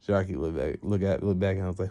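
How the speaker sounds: plain reading voice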